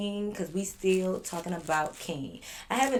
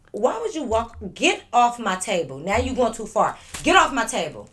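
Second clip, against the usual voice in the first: loud, higher-pitched telling-off; real scolding; 5 semitones higher, 7 dB louder than usual. Real, not acted. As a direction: order the pet off the table, exasperated. Scolding pet walking on table.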